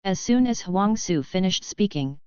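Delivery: usual speaking voice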